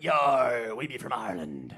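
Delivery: put-on pirate growl passed off as Irish accent; botched Irish accent. Buddy who can't do accents, badly faked piratical Irish accent. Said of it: "'Cause he can't do accents".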